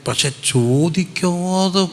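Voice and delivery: slow down and lift for the turn; slow dramatic turn. Shown as a demonstration. Preacher builds to climax.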